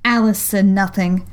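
ordinary delivery

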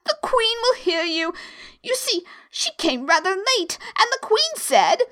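high, anxious rabbit voice; frightened. A narrator's reading. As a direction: warn nervously, confiding gossip. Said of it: "whispered in a frightened tone".

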